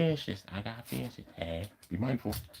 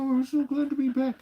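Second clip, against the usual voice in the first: dog's voice. Pretend. Voicing the excited dog.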